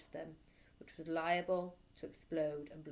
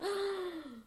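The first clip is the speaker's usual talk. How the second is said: high shocked gasp; child's frightened gasp; 11 semitones higher than usual. Acted. Boy lifted by his hair, gasping in fright.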